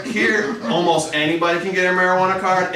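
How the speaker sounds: ordinary talk, nothing marked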